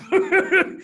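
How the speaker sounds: short high burst of real laughter; laugh, still amazed by it all; happy laugh. Real, not acted. Happy laugh after the story.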